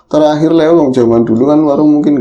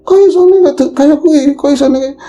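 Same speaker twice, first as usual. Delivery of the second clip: dismayed wife; 13 semitones higher than usual. Retold or imitated. Wife reacts to husband bringing no money, shocked and high. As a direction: voice the wife's shocked protest.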